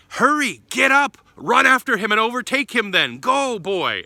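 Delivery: loud and high, urging him to get moving; urgent call. Acted. The monk urging the paralytic boy to run after his father, loud and high.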